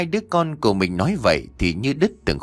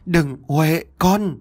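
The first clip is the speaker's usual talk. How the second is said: anguished cry; 6 semitones higher, much slower than usual. Acted. Mother crying out to her daughter, anguished. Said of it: "Bà Cẩm gào lên".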